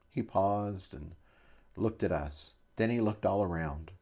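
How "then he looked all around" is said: usual speaking voice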